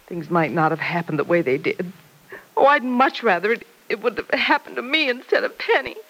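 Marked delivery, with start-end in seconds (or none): 2.6-6.0 tearful lament that breaks into crying. Acted. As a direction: let the tears come through on "instead of Penny". Mother wishes she'd been shot.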